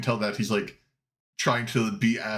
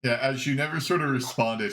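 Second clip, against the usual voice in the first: ordinary talk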